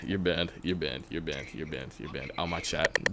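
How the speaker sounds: soft, low repeated dismissal; low, quiet deadpan chant; muttered banishing. Real, not acted. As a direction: mutter it over and over, sinking away. Repeating a ban to a viewer.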